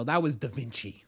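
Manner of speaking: normal talking voice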